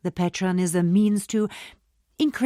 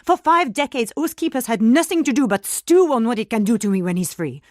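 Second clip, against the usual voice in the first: higher, rising alarm at the spirit's revenge; panicked disbelief; 7 semitones higher than usual. Acted. Oma to her warder, panicked and much higher. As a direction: voice climbing in fear.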